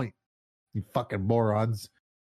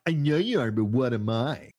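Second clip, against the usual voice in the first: put-on childish taunt. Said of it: "the Pee Wee Herman party".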